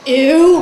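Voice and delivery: a loud, disgusted "Ew". Retold or imitated. Aunt sees her brother's ugly baby, loud disgusted ew.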